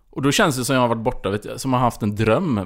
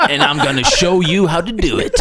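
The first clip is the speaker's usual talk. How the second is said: laughing mock announcement; 5 semitones higher, 4 dB louder than usual. Acting the soldier, laughing.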